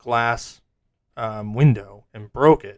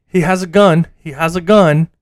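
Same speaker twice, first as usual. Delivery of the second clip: shouted warning; 4 semitones higher, 7 dB louder than usual. Retold or imitated. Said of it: "out loud multiple times".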